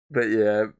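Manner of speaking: usual speaking voice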